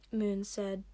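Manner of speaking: usual speaking voice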